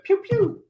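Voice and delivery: playful high laser-gun noise; imitated toy blaster shots; high-pitched pew-pew. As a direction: squeak out little gunshot sounds. Reads out chat's gunshot noise.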